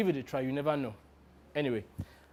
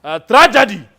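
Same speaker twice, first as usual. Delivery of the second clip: loud, hammed-up warlord lament building to a shout; African warlord; 4 semitones higher, 9 dB louder than usual. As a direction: mourn grandly, then roar for vengeance. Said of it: "in his best impression of an African warlord".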